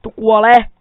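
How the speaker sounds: battle shout. Real, not acted. Gamer strikes again, plain loud battle cry. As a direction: bark the kill cry at the animal. Player attacking animal again.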